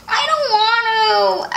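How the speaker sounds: high, slow, whimpering refusal; whiny refusal. Acted. Beatrice whines to Henry, slow and near crying. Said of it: "answered Beatrice".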